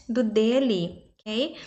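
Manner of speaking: ordinary talk, nothing marked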